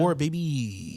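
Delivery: slow, drawn-out delivery; drawn-out catchphrase. Acted. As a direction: stretch the words out slowly. Drawn-out catchphrase about war business.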